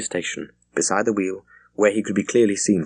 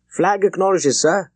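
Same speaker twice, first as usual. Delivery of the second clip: croaked; 10 semitones higher, 4 dB louder than usual. A narrator's reading. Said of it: "croaked Foreman".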